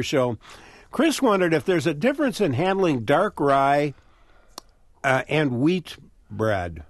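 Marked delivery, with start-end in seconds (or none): none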